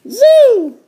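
very high little-sister squeal. Acted. Little sister reading a sign to her brother, very high voice. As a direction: pitch it way up like a cartoon kid sister. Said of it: "said D W".

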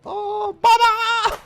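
put-on high, slow pleading child's voice; kid voice. Son begs his dad not to kill him, high child voice. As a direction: beg dad like a scared little kid. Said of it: "diye bağırışlar geliyor".

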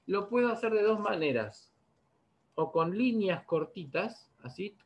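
usual speaking voice

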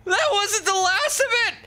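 high-pitched frantic screaming; panicked shrieking. Real, not acted. Panicked shrieking during boss fight.

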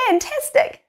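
sudden high bright praise; excited praise. Real, not acted. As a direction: cheer them on with a big up-lift. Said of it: "praising after counting".

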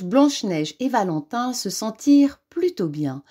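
plain reading voice

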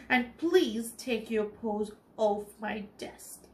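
plain reading voice

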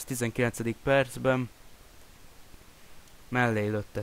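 usual speaking voice

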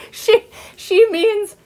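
high, slow, stammered through laughter; gasping with laughter. A narrator's reading. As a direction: try to talk while laughing helplessly. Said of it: "she gasped".

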